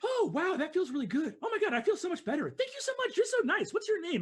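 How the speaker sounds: high, fast, happy gush; high-pitched thrilled Jothra; delighted racer. Acted. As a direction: gush with relief at the kindness. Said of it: "Jothra looks at you and says".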